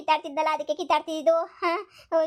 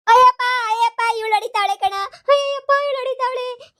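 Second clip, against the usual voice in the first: pained wailing; 9 semitones higher, 5 dB louder than usual. Acted. Being beaten, cries for help.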